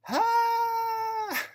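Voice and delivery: one long, loud high-pitched shriek; child's tantrum scream. Acted. Boy told no in the store, screaming loudly. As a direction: let out a piercing, held scream.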